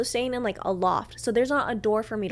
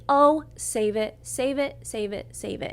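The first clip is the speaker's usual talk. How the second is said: fed-up, trailing dismissal; dismissive cut-off; 2 semitones higher, slower than usual. Retold or imitated. Cutting off his excuses.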